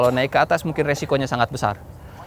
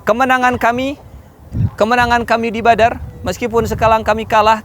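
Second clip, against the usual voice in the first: raised, high defiant cry; shouted retort; 6 semitones higher than usual. Acted. Umar answering Abu Sufyan's taunt, high defiant shout. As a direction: hurl it back at the enemy. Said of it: "Umar bin Khattab kemudian berteriak".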